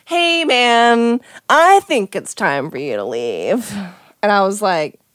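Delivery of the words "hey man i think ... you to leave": lightly voiced stoned guy, drawn out; lazy, slow-stretched mock stoner line; high guy. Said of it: "his high butt literally gets out".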